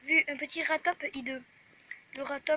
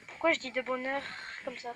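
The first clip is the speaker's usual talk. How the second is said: ordinary delivery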